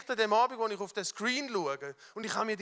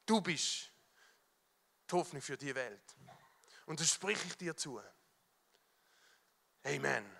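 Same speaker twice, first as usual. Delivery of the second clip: soft blessing; 6 semitones lower, 7 dB quieter than usual. Real, not acted. Pastor blessing the congregation, quiet and tender. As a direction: bless them gently, low and soft. Speaks blessing over listener.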